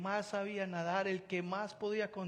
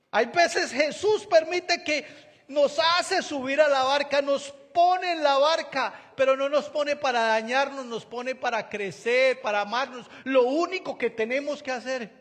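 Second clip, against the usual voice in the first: preaching at full force; 7 semitones higher, 10 dB louder than usual. Real, not acted. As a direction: drive the message home to the congregation. Preacher rises to climax. (predicación a todo pulmón)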